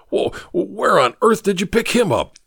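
stammering, shocked gasp. Acted. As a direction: catch breath in shock. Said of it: "gasped Ricketts".

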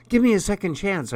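fast, high desperate plea; crying out. Acted. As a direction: blurt it out pleading. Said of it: "I cried out".

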